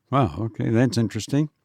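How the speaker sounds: ordinary delivery, nothing marked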